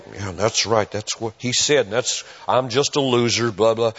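low, muttered brush-off of a churchgoer going home; a mumbled mock of someone dodging the point; dismissive mutter. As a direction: shrug it off under your breath.

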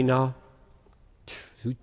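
ordinary talk, nothing marked

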